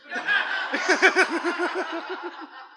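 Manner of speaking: laughing at own joke. Real, not acted.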